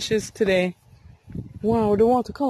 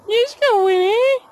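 calling out; 12 semitones higher, 3 dB louder than usual. Real, not acted. Calling the dog.

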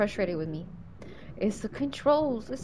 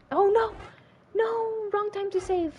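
high-pitched dismayed oh no; dismayed cry; 10 semitones higher than usual. Real, not acted. Gamer realising she saved at the wrong moment, high dismayed cry. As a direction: yelp in alarm at the mistake.

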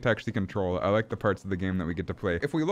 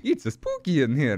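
cartoon Italian with added vowels; playful mock-Italian plumber accent; 4 semitones higher than usual. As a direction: ham up a fake Italian accent. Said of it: "I'm so good at this Italian accent".

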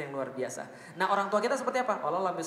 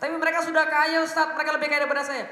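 high, loud protest to the Ustadz; objecting listener; 8 semitones higher, 7 dB louder than usual. Retold or imitated. Student protesting to the Ustadz that his parents are rich, loud and high. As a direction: push back indignantly.

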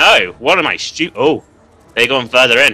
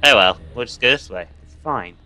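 ordinary talk, nothing marked

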